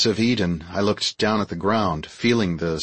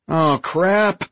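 higher-pitched exasperated groan of words; mild frustration; 8 semitones higher than usual. A narrator's reading. Android pilot realizes his oversight, mildly frustrated. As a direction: let out a touch of exasperation. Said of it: "rolled my eyes in frustration".

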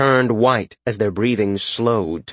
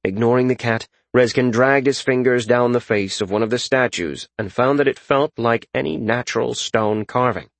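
plain reading voice

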